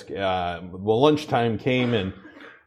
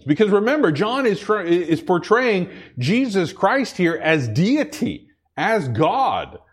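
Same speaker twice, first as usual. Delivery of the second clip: preaching; 6 semitones higher than usual. Acted. Preaches on John six.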